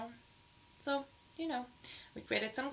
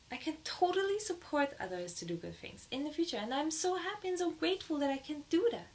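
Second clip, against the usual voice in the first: higher, bright and eager; excited joyful affirmation; 6 semitones higher than usual. Shown as a demonstration. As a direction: say it like you truly mean it, happily.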